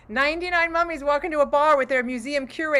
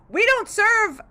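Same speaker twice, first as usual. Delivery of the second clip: high-pitched, emphatic bartender; refusing bartender; 8 semitones higher, 4 dB louder, slower than usual. Acted. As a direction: turn them away in a raised voice. Bartender refusing mummies in joke.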